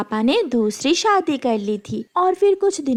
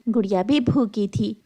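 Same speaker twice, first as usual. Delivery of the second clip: ordinary delivery, nothing marked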